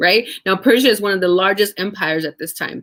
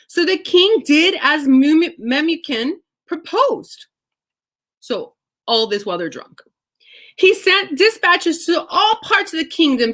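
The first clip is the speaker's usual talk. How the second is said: scripture reading; 8 semitones higher than usual. Acted.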